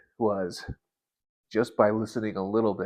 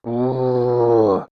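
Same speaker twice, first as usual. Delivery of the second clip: emotional ooh; 4 semitones higher, 9 dB louder than usual. Real, not acted. Overcome after God's message.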